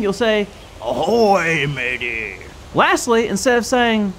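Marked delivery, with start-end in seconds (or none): 0.8-2.4 low, slow growling pirate voice. Shown as a demonstration. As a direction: rumble it like a salty old pirate. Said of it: "lower your voice to, uh, like a, like a growl".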